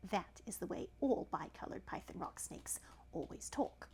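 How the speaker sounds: soft unvoiced narrator aside; whispered aside. A narrator's reading. Narrator whispering an aside to the listener. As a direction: whisper it to the best beloved.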